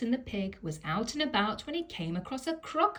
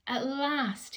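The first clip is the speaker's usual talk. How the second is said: weary sigh; 6 semitones higher than usual. Acted. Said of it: "he sighed".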